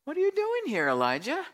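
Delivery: high-pitched pointed question, dropping on the name; questioning God. Acted. God questioning Elijah, first telling.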